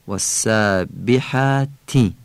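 والسابحاتی slowly, ending in a short i; Arabic kasra. Shown as a demonstration. Teacher showing the Arabic vowel. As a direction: close والسابحاتی with a clear i. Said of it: "کسره رو نیز عربی بگیم".

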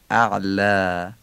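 اعلا with the last vowel drawn out as a fronted "aa"; long light final alef. Shown as a demonstration. Teacher demonstrating the last alef of اعلا, long light vowel. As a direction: stretch the end of اعلا thin and long.

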